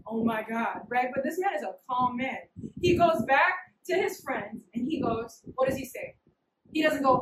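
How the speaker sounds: usual speaking voice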